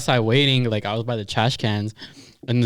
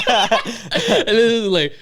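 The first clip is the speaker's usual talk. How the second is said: giggling through the words, slow; can barely talk for laughing; 9 semitones higher, 3 dB louder, much slower than usual. Real, not acted. Cracking up retelling corn dog.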